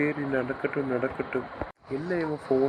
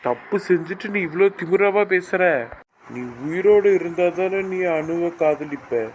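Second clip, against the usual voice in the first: loud, furious accusation; enraged shouting; 3 semitones higher, 8 dB louder than usual. Acted. Uncle attacks nephew over his affair, shouting in rage. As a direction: shout it as a deadly threat.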